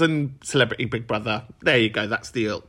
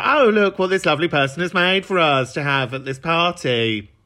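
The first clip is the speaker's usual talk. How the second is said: higher, put-on presenter gush; 4 semitones higher, 3 dB louder than usual. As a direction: show off the dish to listeners. Acts out her gushing broadcast link.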